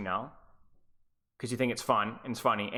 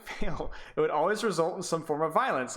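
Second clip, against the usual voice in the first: usual speaking voice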